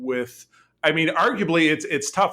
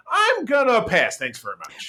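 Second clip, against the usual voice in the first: dismissive Peanut Hamper; 7 semitones higher than usual. Retold or imitated. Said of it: "And she's like".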